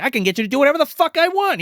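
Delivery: hammed-up domineering dummy, fast; gloating dummy. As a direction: crow over your victim as the puppet. Dummy Fats domineering Corky.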